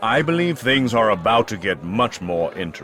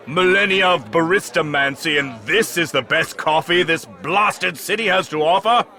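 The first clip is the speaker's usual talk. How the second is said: contemptuous; 8 semitones higher than usual. Acted. Sorcerer berating servant.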